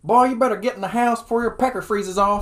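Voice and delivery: put-on Southern accent. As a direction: holler at the kid from the porch. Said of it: "turn on the Southern accent".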